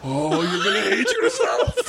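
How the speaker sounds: high giggly gloating; imitating laughing Tom. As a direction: tease him for leaving early. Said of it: "He's like".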